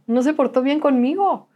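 high-pitched, lightly plaintive complaint; retold tearful protest. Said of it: "yo lloraba y decía".

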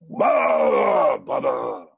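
a sudden loud, odd burst of blahs; mock shout. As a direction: bark out nonsense syllables at the hiding man. Acting out a trial take on set.

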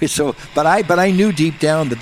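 ordinary delivery

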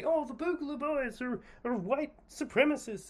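high, mocking voice of the other side; mocking quote. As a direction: squeak it out like a know-it-all mocking them. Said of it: "There are people who say".

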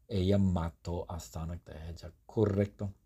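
normal talking voice

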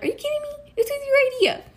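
high-pitched indignant comeback; exaggerated, high "are you serious" tone; mock incredulity. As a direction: retell it in a high, disbelieving voice. Retelling an exasperated comeback to sister.

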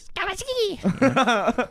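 put-on very high, very slow silly baby babble; Adam Sandler baby voice. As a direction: stretch it out in a goofy baby voice. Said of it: "very, uh, Adam Sandler baby voice".

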